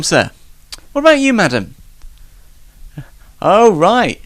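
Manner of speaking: normal talking voice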